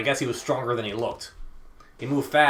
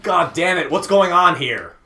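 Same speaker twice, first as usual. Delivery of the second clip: loud, higher, furious villain; angry shout; 6 semitones higher, 6 dB louder than usual. Acted. Man bursts in to find the room empty, angry shout. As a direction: demand answers at full volume. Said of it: "an angry face was staring in".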